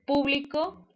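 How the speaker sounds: público with the stress on PÚ; stress on first syllable. Shown as a demonstration. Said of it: "No es lo mismo, eh, decir". (público con acento en la primera sílaba, PÚ)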